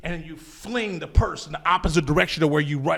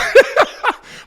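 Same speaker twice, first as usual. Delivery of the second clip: high laugh; 8 dB louder than usual. Real, not acted. Laughs at own story.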